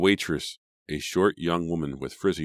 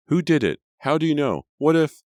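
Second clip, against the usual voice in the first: much higher, louder, frantic questions; desperate cry; 12 semitones higher, 9 dB louder than usual. A narrator's reading. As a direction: cry out in panic. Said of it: "in a desperate voice".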